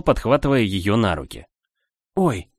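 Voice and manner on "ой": startled cry. Acted. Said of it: "Арда испуганно вскрикнула".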